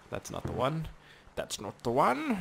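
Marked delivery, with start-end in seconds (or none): 1.3-2.4 higher mock-character repeat; playful higher-pitched put-on voice. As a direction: echo it in a silly, high voice.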